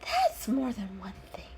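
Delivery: a hissed whisper. A narrator's reading. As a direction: hiss it out under the breath.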